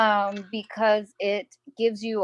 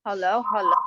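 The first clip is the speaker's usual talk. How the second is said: talking with mouth full; words round a bite of food; eating; faster than usual. Real, not acted. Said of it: "I'm eating".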